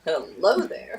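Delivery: imitated smitten old charmer, swooping up; low start, sliding into a suggestive lift; flirty grandfather. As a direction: play the suddenly revived flirt. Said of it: "hot nurse would come in".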